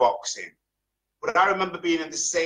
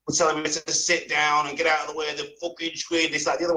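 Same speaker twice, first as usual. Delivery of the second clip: normal talking voice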